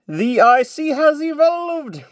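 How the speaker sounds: slow, high-pitched excited reveal; excited announcement. Real, not acted. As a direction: announce the big news with delight. Announces the evolution.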